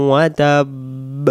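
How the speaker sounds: drawn-out melodic recitation; chanted Quranic recitation; slow Quran recitation. Shown as a demonstration. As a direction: recite it slowly and devoutly. Recites verse slowly.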